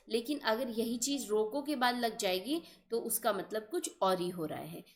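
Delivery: normal talking voice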